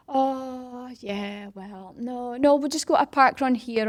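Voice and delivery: hemming and hawing, drawn-out opening; reluctant excuse. Retold or imitated. Club member dodging starting a park run, reluctant. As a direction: imitate someone wriggling out of it.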